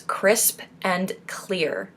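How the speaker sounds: "crisp and clear" slowly, each consonant popped; crisp, exaggerated consonants. Shown as a demonstration. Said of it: "exaggerating each consonant".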